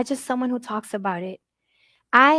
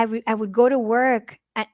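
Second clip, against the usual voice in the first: ordinary talk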